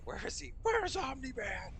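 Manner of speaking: high, strained council yell; hammed-up high yell; mock yell. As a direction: shout it like the council hunting Omni-Man. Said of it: "the Immortal Council yelling".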